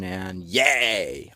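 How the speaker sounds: mock little celebration yay; cheer. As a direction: cheer yourself on.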